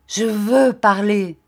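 volonté farouche, fort et lent; enflammée. A narrator's reading. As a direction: imposer sa parole en appuyant. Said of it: "de ses yeux de flamme". (ton farouche et enflammé)

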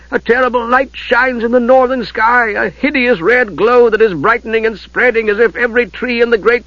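high, frightened voice; awed young squire. Acted. Terrified squire describing the fire to his lord. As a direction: tell of the burning north in dread.

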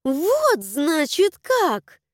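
high, outraged exclamation. A narrator's reading. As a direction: gasp it out in outrage. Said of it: "задохнулась от возмущения".

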